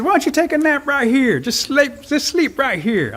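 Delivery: high-pitched, sweetly persuasive invitation; coaxing. A narrator's reading. Rabbit talks full-bellied Flint into a nap, coaxing. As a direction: coax him gently down to sleep, voice lifted.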